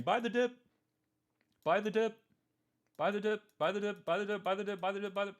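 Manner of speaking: mocking dip buyers. Mocking traders who chant buy the dip, high and loud. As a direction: parrot it like a hyped cheerleader. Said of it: "sitting here and saying".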